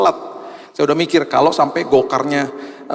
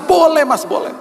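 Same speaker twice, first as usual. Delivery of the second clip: tearful driver; 4 dB louder than usual. Retold or imitated. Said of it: "Selama perjalanan dia nangis".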